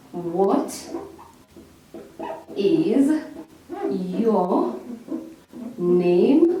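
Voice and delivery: very slow, long pause after each word. Shown as a demonstration. Teacher spelling out the example question, word by word. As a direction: separate "What", "is", "your", "name" with long gaps. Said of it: "indicate the intonation that this sentence falls".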